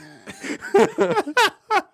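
high-pitched burst of laughter; howling at his own joke; high laughing fit. Real, not acted. Host cracking up at his own bad accent, laughing.

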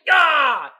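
loud, high mock yell; imitated woman's yell. Host mimics the woman on the sign, loud mock yell. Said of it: "with the woman".

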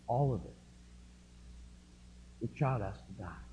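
a doctor's soft, grave verdict; grave quiet doctor. Retold or imitated. Doctors telling the parents their child must die, softly. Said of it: "the doctors say".

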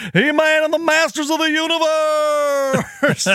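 high, slow game show boom; booming prize announcer. Retold or imitated. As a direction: announce it like the team is arriving. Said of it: "like a game show announcer".

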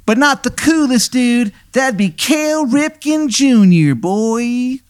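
high, hammy Baltimore "hun" voice; the worst Maryland accent you've ever heard; bad Maryland accent. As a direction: play a ghost with a terrible Maryland accent, way up high. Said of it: "And he goes".

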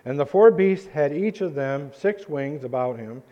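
ordinary talk

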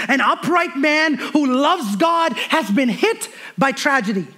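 slow, high, loud sermon delivery; building pulpit intensity, drawing words out; full-force preaching. Acted. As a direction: preach each blow of Job's story to the crowd. Preaching the Job story.

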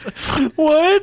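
high, loud, hammed-up wail; mock crying outburst. Stranger told his zipper is down, mock tearful outburst. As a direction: play wounded and outraged, stretching the words out. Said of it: "He starts crying and he goes".

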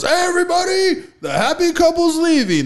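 high, loud mock call to the room; mock announcing yell. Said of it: "somebody yells".

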